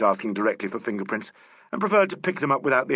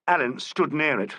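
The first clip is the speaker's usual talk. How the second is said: plain reading voice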